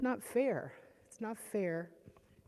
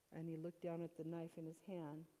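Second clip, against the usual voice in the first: normal talking voice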